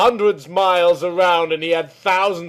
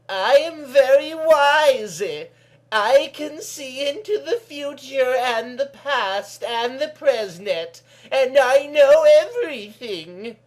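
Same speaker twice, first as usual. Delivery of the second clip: put-on wise old woman; 9 semitones higher than usual.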